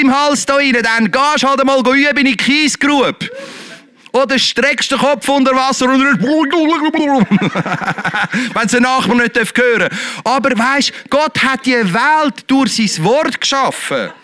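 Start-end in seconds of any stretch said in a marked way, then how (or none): 6.1-8.5 laugh. Real, not acted. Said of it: "laughs at own joke".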